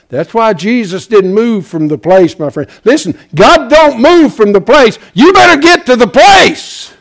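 building to a high pulpit shout; full-force preaching. Real, not acted.

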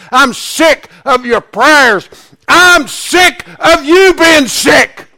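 loud, high preacher voicing God's anger; angry God. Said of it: "Because he's angry".

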